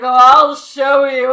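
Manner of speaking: loud, slow leering boast. Retold or imitated. Shorty answering Mai, a loud slow leering boast. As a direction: boom it out like an eager lech. Retelling a horny anime scene.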